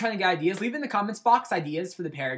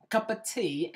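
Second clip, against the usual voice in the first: higher-pitched fake British echo; badly faked British, pitched up; mock British accent; 5 semitones higher, slower than usual. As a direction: repeat it in a playful British accent. Echoes his fake British opening.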